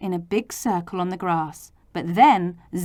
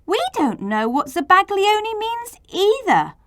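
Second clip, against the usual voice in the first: much higher calling out; children crying out; 12 semitones higher than usual. A narrator's reading. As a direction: call it out like the children. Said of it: "But the children all cried".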